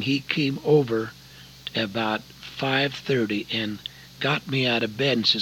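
usual speaking voice

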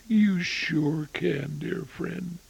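slow, soft and kind; gentle reassurance. Retold or imitated. Preacher welcoming a crying drunk, slow soft reassurance. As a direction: ease a broken man's fear gently. Comforting a crying alcoholic at church.

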